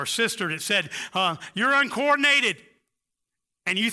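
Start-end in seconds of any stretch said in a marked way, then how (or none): 1.5-2.6 imitating a parent's scornful put-down, higher and louder; mocking put-down. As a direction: sneer it like a belittling parent. Parent's put-down quoted in sermon.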